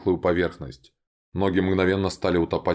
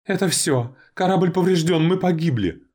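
high, loud panicked yell; 11 semitones higher, 4 dB louder than usual. A narrator's reading. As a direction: scream that the ship is lost. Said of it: "проорал один из скалопендр".

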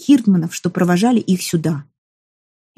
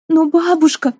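high tearful exclamation; tearful cry; 8 semitones higher than usual. Acted. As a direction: cry out close to tears. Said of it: "со слезами на глазах воскликнула".

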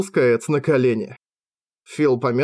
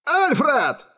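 anguished cry; 13 semitones higher than usual. A narrator's reading. Father cries son's name.

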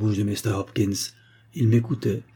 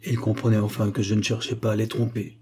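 plain reading voice

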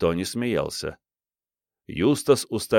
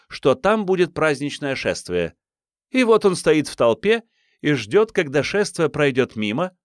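fake Irish accent; 9 semitones higher than usual. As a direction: ham up a silly Irish accent. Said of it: "в фальшивом ирландском акценте женщины". (фальшивый ирландский акцент, высоко)

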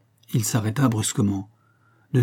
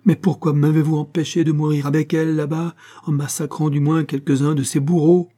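agitated, higher-pitched reproach; crying out; 5 semitones higher, 4 dB louder than usual. A narrator's reading. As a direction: protest in a raised, urgent voice. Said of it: "s'écria Lucien".

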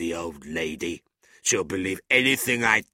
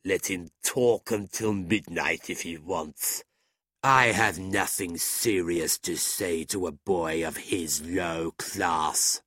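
slow, put-on contemptuous drawl; scorn; slower than usual. A narrator's reading. Drunk gentleman dismissing challenger to friends, scornful. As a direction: dismiss him lazily, stretching the last words. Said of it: "looked at Nicholas with scorn".

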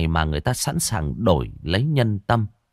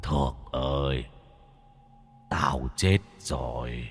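low, slow, hollow ghostly call; a dead man beckoning from beyond, slowly; 5 semitones lower, 5 dB quieter, slower than usual. A narrator's reading. Dead man's ghost calling out. (giọng ma trầm, chậm, vọng lại)

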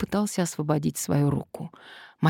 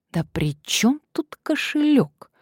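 irritated; 9 semitones higher, slower than usual. Acted. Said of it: "Мария начала злиться".

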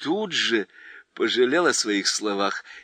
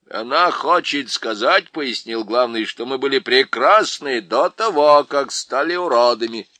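louder, important explaining; pompous chief; 7 dB louder than usual. A narrator's reading. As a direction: lecture the others grandly. (важно, громче обычного)

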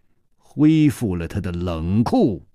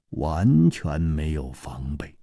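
ordinary delivery, nothing marked

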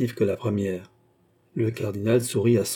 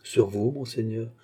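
usual speaking voice

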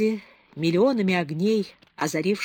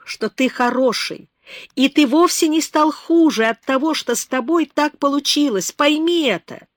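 high, loud, urgent outpouring; impassioned pleading; 8 semitones higher, 8 dB louder than usual. A narrator's reading. Beba pleads with lonely Herardo, loud and high. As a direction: pour out your heart, begging him to believe. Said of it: "крикнула она".

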